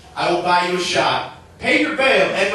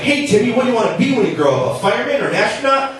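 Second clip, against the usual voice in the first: cheery adult; 7 semitones higher, 3 dB louder, faster than usual. Pretend. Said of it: "It's like".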